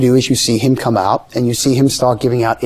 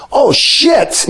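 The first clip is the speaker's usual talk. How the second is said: sudden high shout of shock, imitated; Johnny Carson's shocked outburst; 13 semitones higher than usual. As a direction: blurt it out, caught completely off guard. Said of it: "you hear Johnny go".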